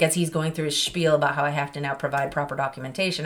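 usual speaking voice

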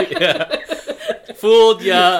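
loud high laughing yell. Real, not acted. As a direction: burst out laughing as you shout it. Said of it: "laughing after accent bit".

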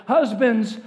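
called out loud in a put-on deep voice; mock deep voice. Shown as a demonstration. Pastor has the men call out in a deep voice. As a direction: boom it out like a proud husband. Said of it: "say it with a, a really nice, deep voice".

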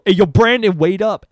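put-on boys calling out. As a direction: call out to a buddy down the hall. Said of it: "I hear some boys go".

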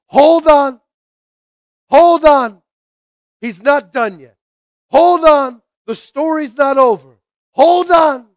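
loud, high, driving exhortation; rally the congregation to hold on; full-force preaching. Real, not acted.

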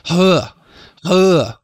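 breathy huh, huh sounding out the h; copying the breathy h sound; mock breathy huh. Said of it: "So it's like".

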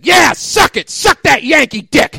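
high, loud mock shout; hammed-up exclaim. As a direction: yell it like a cartoon hero in ecstasy. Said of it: "he exclaimed".

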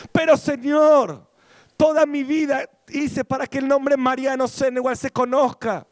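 high, loud pleading protest; whining complaint. Pretend. Man in heaven protesting his lost fame, high and loud. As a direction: complain to the Lord like a let-down celebrity.

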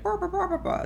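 high squeaky babble; gibberish game voice. Retold or imitated. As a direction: babble like a game character instead of real words. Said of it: "type stuff to save on voice acting".

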